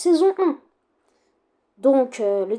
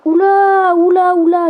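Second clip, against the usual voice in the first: alarme soudaine; 5 semitones higher, 10 dB louder, slower than usual. Real, not acted. Il repère une erreur dans la vidéo.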